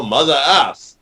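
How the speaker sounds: slow, put-on Russian-accented prince. Creator voicing the warrior Red Prince, Russian accent. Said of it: "a Russian".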